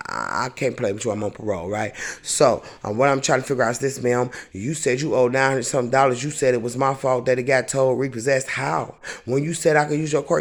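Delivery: ordinary delivery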